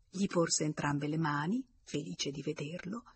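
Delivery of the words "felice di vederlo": usual speaking voice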